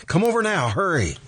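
imitating her urgent summons; urgent plea quoted. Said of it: "she said".

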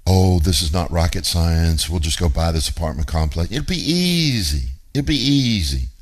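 mock their cockiness, pitch climbing on the last phrases; mock arrogant. Family boasting before buying an apartment complex, mock arrogant. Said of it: "They arrogantly said".

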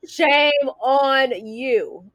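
"shame on you" stretched very slow, a teasing near-cry; playful slow tsk-tsk at a friend; drawn-out mock scolding. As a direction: drag out "shame" like a fake sob of disapproval. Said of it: "Oh, shame on you".

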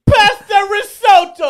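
slow, high, loud Italian chef cry; mock Italian shout. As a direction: belt it like a hammy Italian waiter.

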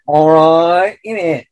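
high mock-London accent; comic high London bird voice; London pigeon.

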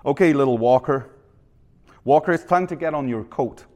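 attempted Canadian accent for a dad; lightly put-on Canadian dad. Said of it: "my best Canadian accent".

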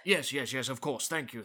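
ordinary talk

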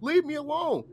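high-pitched, exasperated mock yell; retold yelling. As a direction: yell at the cop in the retelling, voice shooting up. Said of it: "And so I'm yelling".

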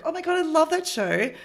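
high-pitched retold enthusiasm; imitated gushing date. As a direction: gush like an eager dating-app match. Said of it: "someone being like".

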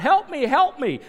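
high, loud mock call for help; drowning cries. Drowning people crying to the boat, mock calls. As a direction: cry out like someone going under.

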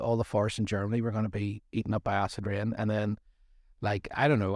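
normal talking voice

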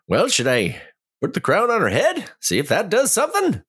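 high, light character voice. Acted. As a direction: speak up high as your character. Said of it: "And I'm gonna say".

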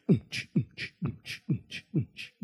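low, muffled thumping beat noise; soft, rhythmic "unch" pulses; imitated party beat. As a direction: mimic dance music thumping from a distance. Imitates party music heard outside.

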